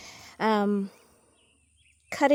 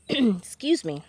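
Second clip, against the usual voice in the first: just swallowed a bug; slower than usual. Real, not acted. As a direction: cough then slow excuse me. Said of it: "I swallowed a little gnat".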